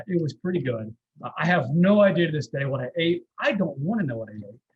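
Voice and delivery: normal talking voice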